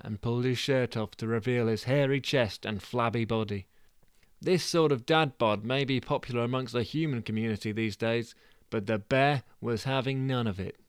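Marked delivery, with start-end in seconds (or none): none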